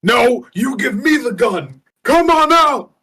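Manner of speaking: high, drawn-out shouted command; Pizzarelli. Acted. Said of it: "Pizzarelli just yells".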